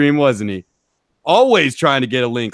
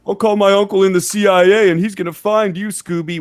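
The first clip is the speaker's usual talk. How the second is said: high, louder mocking whine; mocking kid voice; 6 semitones higher, 4 dB louder than usual. Taunting Scooby on stream, high mocking kid whine. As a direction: sneer it back like a bragging child. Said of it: "We've all heard that shit before".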